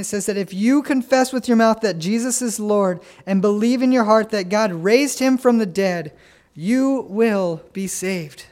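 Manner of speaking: loud, building preacher's proclamation; fervent pulpit preaching; preaching at full force. Acted. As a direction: press the scripture on the room.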